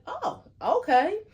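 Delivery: high, drawn-out "oh, okay"; imitated polite surprise. Host retells reacting to news of an old connection, mock surprise. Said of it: "And I'll be like".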